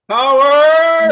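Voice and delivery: very high, loud drawn-out shout; power-mad yell. Pretend. Pretending to reply to internet commenters, power-mad yell. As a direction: bellow it like a power-crazed cartoon figure. Acting gleeful internet-troll fighter.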